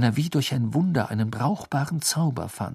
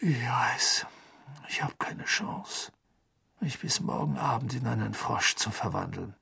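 much softer, half-whispered resignation; resigned; 6 dB quieter than usual. Acted. Harry giving up on the frog plan, softly. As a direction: let it sink into a defeated murmur. Dejected Harry admits defeat.

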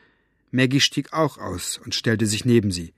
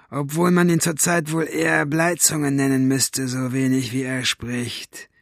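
catlike mockery, slowing toward the end; mock the absent man, drawing out the last words; mocking villain; 3 semitones higher than usual. A narrator's reading. Basta jeering about the silent father, mocking villain.

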